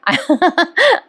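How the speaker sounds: high, gleeful giggle; amused laugh. Real, not acted. Narrator previewing the bhang mix-up, high giggle. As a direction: giggle in anticipation.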